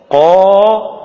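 long قا from the very back of the tongue; drawn-out demonstration of قا with a uvular q; qaf articulation. Shown as a demonstration. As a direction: hold قا, made as far back as possible. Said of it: "بعيد خالص في آخر اللسان".

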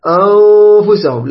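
أنفسهم with the noon hidden in a long hum; ikhfa nasal hold. Shown as a demonstration. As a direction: draw out the hidden ن of أنفسهم before the ف. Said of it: "الأخطاء اللي تصير في الإخفاء".

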